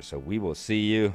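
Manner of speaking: ordinary talk, nothing marked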